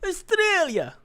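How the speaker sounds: high, loud Italian-style exclamation; over-the-top, dubious Italian accent; mock Italian accent. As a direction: belt it out in a hammed-up Italian accent. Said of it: "Is that Italian?".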